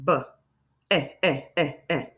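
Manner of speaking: short B, then "eh" in four separate beats; vowel tapped repeatedly. Shown as a demonstration. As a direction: bounce the short "eh" after B. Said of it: "the vowel sound is always that second sound".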